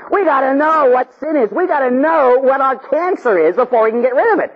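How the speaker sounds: high, urgent preacher's cry; impassioned preaching. Acted. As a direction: preach it insistently at full pitch. Preaches at full force.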